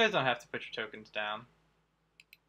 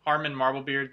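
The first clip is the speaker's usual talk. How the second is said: usual speaking voice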